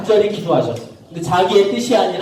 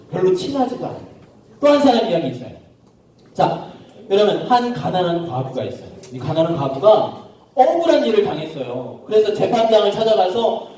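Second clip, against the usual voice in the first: normal talking voice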